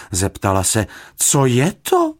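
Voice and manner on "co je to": high, loud motherly fuss; a busy housekeeper caught out. A narrator's reading. Housekeeper asking.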